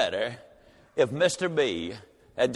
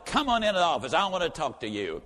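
usual speaking voice

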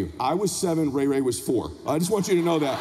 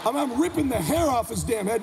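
normal talking voice

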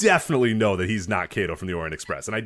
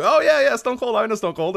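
much higher and louder, gushing fan; mock excited fan; 11 semitones higher, 3 dB louder than usual. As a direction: gush like a starstruck fan.

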